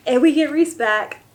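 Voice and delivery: high, loud and thrilled; excited. Real, not acted. Telling her viewers Rhys comes back, overjoyed. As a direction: burst out with joy at the good news. Reader celebrating the hero's return.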